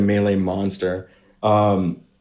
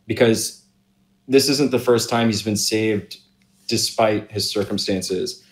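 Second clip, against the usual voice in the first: normal talking voice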